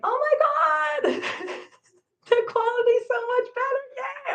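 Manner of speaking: high squeaky excited gush, laughing; delighted squeal at the stream quality; giddy squeal. Retold or imitated. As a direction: squeal it like a delighted little girl. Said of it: "I was like".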